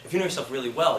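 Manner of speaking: normal talking voice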